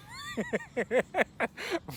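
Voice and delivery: high giggling laugh; real laugh. Real, not acted. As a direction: break into high laughter at your joke. Laughs at own godchild joke.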